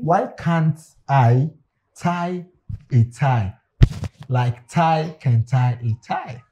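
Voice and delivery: each word spaced out slowly; slow, careful. Shown as a demonstration. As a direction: spell it out word by word. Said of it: "let me explain the way".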